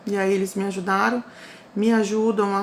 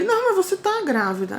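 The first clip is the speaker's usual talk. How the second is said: "Não" pushed high, insisting she is pregnant; retold doctor firmly insisting, higher; insistent doctor; 10 semitones higher than usual. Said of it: "Aí ele". (médico insistindo, mais agudo)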